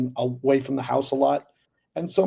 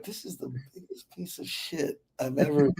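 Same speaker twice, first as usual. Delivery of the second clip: muttered aside; 8 dB quieter than usual. Retold or imitated. Private verdict on script.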